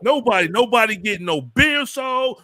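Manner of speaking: high-pitched mock boasting. Host imitating a rapper laying down rules, high mock bluster. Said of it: "T I going around Atlanta talking about".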